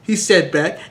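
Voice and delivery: normal talking voice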